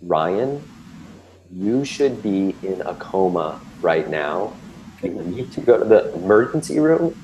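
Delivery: slow, grave doctor's warning; slow and serious, leaning in; really slowly. Retold or imitated. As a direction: spell it out slowly so it sinks in. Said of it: "he really slowly talks to me".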